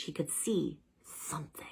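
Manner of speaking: soft unvoiced suspense whisper. A narrator's reading. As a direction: hush it mysteriously.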